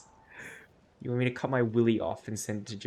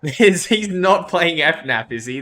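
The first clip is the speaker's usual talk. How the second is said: laughing disbelief; 4 semitones higher, 8 dB louder than usual. Real, not acted. Laughing disbelief at game reveal.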